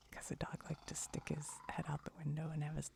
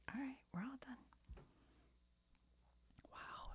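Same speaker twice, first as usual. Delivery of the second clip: hushed, breathy whisper all through; ASMR whisper; 2 semitones higher, 5 dB quieter than usual. Real, not acted. Whispering to viewers while grooming her cat. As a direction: keep it whispered like an ASMR video.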